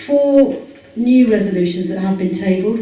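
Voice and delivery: ordinary talk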